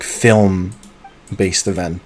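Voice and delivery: ordinary delivery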